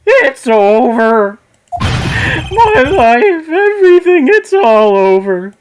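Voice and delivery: high, loud hammed-up wail; [name] wails to Phoenix in hammed-up despair; despairing shriek. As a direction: scream it like a dramatic boyfriend. Client screaming in despair.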